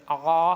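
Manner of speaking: آ squeezed from the throat; ain sound. Shown as a demonstration. Teacher asking learners to copy, throat-made آ. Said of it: "عین کی آواز نکالنی ہے".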